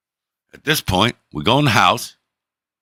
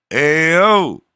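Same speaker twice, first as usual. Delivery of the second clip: loud, high, drawn-out holler; calling across the room; 10 semitones higher, 7 dB louder than usual. Retold or imitated. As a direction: holler to get someone's attention across a room. Calls across room, everyone looks.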